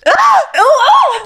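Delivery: high gasping oh; shocked gasp. Real, not acted. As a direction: gasp then squeal out oh. Reacting to the question.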